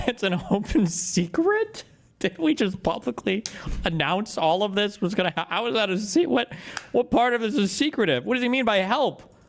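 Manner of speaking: high, laughing disbelief at first; incredulous. Real, not acted. Streamer questioning how any of this is secret, incredulous.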